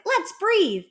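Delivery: high-pitched, sing-out invitation; over-announced cheery prompt. Shown as a demonstration. Coach showing parents how not to start breathing, over-announced. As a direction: overdo it as a big announcement to a child. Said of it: "I wouldn't set it up as, like".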